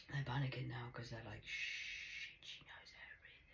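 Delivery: frightened whisper. Retold or imitated. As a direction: hush it like a secret. Paranoid whisper.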